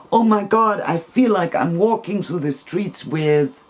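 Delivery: retold amazement. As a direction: act out an astonished coworker. Coworker acted out, exclaiming at her.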